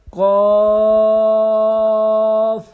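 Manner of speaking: قاف loud, long, with a bounce; loud bouncing قاف; bouncing Qaf. Shown as a demonstration. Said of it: "read by bouncing voice".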